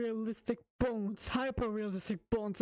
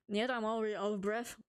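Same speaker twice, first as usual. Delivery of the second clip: ordinary delivery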